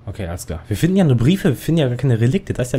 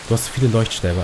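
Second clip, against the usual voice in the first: ordinary talk, nothing marked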